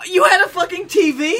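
high, cracking indignant question; can't believe he got a TV; outraged disbelief. Real, not acted. Friend outraged at TV news.

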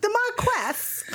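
high, slow mock British; hammed-up British with a laugh; British accent. Shown as a demonstration. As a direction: draw it out in a put-on British accent, amused. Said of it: "let's say it in a British accent".

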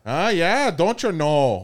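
high, loud Upper Midwest lilt; North Dakota accent. Pretend. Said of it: "North Dakota accent".

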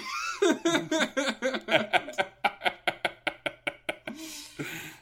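long high wheezing laugh; high rattling giggle fit; helpless laughter. Real, not acted. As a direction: lose it completely, laughing on and on. Cracks up at the pickle bit.

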